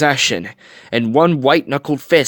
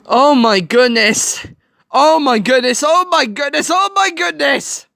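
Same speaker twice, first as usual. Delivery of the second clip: repeated gasp climbing higher, slower; getting more and more worked up; overwhelmed; 12 semitones higher than usual. Real, not acted. Viewer overwhelmed by aunt Cass's promise, rising distress.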